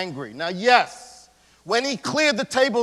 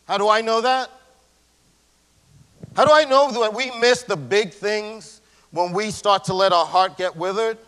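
ordinary delivery

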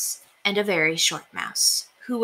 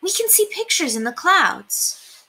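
higher, delighted mother voice; mother mouse; 12 semitones higher than usual. A narrator's reading. Mother telling son about clouds, delighted. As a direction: wonder at the sky. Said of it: "said Mother".

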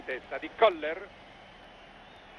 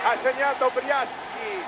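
higher, slower excited correction; breathless italian goal commentary; 5 semitones higher, slower than usual. Real, not acted. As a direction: still riding the goal excitement. Correcting scorer after goal. (annuncio del gol concitato)

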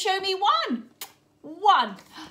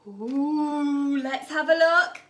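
plain reading voice

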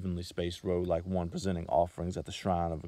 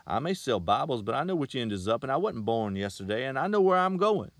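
louder and higher, rising to indignant outburst; 5 semitones higher, 7 dB louder than usual. A narrator's reading. Salesman boasting to the girl, louder and indignant. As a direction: puff up with offended pride. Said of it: "in a lofty, indignant tone".

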